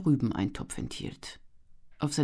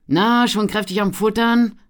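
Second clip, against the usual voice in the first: loud, higher teasing question; good-humoured; 8 semitones higher, 11 dB louder than usual. A narrator's reading. Jovial traveller teasing Armin about his meal, good-humoured. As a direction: tease him cheerfully. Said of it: "fragte er gut gelaunt".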